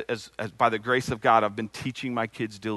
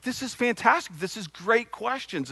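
higher, eager "this is fantastic"; retold delight; 9 semitones higher than usual. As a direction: rerun the thrill of a good question. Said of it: "And I was like".

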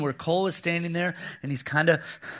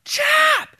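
put-on very high, loud child's shriek; five-year-old screaming for his brother in the dark; imitated scream; 7 dB louder than usual. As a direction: scream the name like a frightened little boy. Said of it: "Cole is now screaming".

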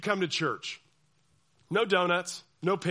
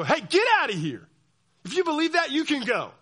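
louder, higher hostile dismissal; mock church scolding; 8 semitones higher, 7 dB louder than usual. Church leaders expelling questioner, loud mock anger. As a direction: kick them out harshly. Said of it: "who are called to love you to go".

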